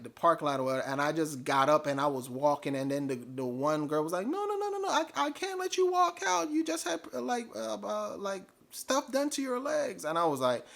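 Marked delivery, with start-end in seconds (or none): 4.3-10.0 very high put-on voice of a concerned staffer; worried protest. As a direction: imitate her squeaky, urgent objection. Staff girl stops patient walking out.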